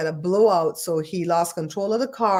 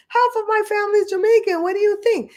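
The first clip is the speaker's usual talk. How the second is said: much higher put-on voice for the quote; imitating Kamala Harris; 13 semitones higher, 3 dB louder than usual. Retelling Kamala's pot answer to Charlamagne, high mocking imitation. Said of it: "And she goes".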